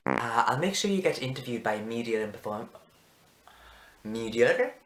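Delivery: halting attempt at an Irish accent; wobbly Irish lady impression. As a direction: feel your way through an Irish lilt. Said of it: "I can't say that in an Irish accent".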